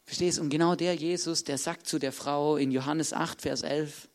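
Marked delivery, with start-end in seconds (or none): none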